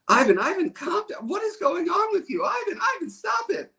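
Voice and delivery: put-on squeaky panicked teacher pleading with a boy; teacher scolding. Retelling his third-grade teacher calming Ivan, high-pitched mock panic. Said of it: "And the teacher says".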